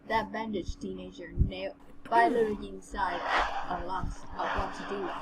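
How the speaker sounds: ordinary delivery, nothing marked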